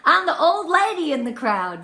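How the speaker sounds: ordinary talk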